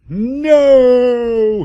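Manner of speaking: hammed-up scream. Villain stabbed in the heart, hammed-up high scream. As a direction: shriek it like a cartoon villain dying. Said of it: "he screamed".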